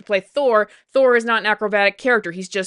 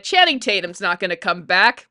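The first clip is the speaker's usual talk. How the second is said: normal talking voice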